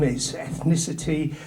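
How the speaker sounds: normal talking voice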